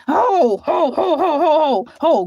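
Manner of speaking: rhythmic "ho, ho" laugh. Real, not acted. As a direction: laugh it off in short bursts. Laughing after Grandma's reminder.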